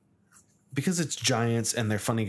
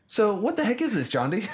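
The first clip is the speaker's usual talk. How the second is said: surprised outburst; 11 semitones higher than usual. Real, not acted. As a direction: blurt it out at the strange box. Host reacts to odd new game.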